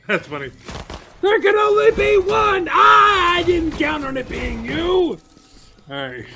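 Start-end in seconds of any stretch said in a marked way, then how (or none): 1.2-5.2 high, loud theatrical yell; hammed-up showdown cry; mock-villain shout. Repeats showdown line, shouting.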